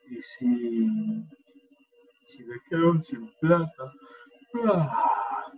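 slow, sleepy inner voice fretting; yawning, heavy-lidded worrying; drowsy worried voice. Shown as a demonstration. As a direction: let each worry drift out half asleep. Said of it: "noten cómo esa voz es así, relajada, dormida, somnolienta".

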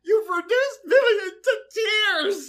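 high, slow, laughing through the line; mock-tearful quote dissolving into laughter; half-laughing, half-crying imitation of Arthur. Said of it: "Arthur says".

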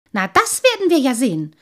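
irate, determined comeback; snorted retort. A narrator's reading. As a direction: snort back indignantly. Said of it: "schnaubte der Lange".

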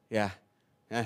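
normal talking voice

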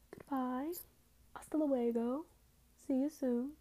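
chirpy multilingual sign-off; bright singsong farewell. Real, not acted. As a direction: wave everyone off in a cheerful lilt.

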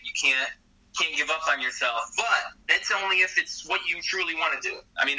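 ordinary delivery

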